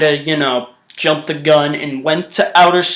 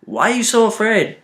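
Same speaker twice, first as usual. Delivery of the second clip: softer; 7 dB quieter than usual. Retold or imitated. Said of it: "I'm like".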